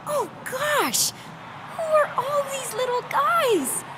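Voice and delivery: very high, gushing excitement; thrilled, sky-high cooing; delighted squeal. Acted. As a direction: squeal over cute little creatures. Gushing over newly seen little creatures.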